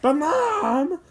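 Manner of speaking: high, loud protest to mom; protesting kid. A narrator's reading. Boy protesting to his mother.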